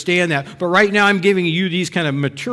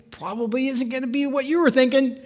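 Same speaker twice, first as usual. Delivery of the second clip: usual speaking voice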